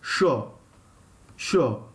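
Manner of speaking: "Sure" said with sh, like shore. Shown as a demonstration. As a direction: say "Sure" as sho, with the sh sound. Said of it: "the pronunciation is S H".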